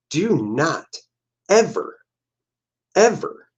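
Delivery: "ever, ever" rising high and drawn out; stress on ever. Real, not acted. Warning married guys, stressing "ever" high and slow. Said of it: "emphatic warning to guys".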